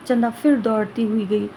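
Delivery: plain reading voice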